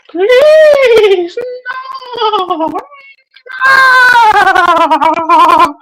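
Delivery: hammed-up scared cry of no; mock pleading. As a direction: plead like a cornered cartoon victim. Pretend victim begging.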